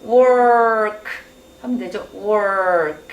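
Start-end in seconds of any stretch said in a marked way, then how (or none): 0.0-1.0 exaggerated American R in work; rolled-tongue work. Shown as a demonstration. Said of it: "혀를 막 굴려요". 2.1-3.1 heavy R in Work; rolled-tongue work. Shown as a demonstration. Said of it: "혀를 막 굴려요".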